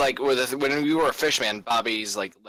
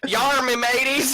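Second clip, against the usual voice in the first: pirate voice; 9 semitones higher, 3 dB louder, slower than usual. Acted.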